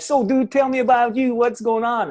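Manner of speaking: high, bright-pitched mock American bro voice; American accent. To the group host, a mock American accent. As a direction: do your best American dude. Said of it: "that's my American accent".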